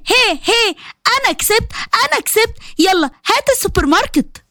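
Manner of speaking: high gleeful chant; childish cheering. Acted. Bushra bouncing to the song, gleefully claiming her win. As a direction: crow like a kid who won a bet.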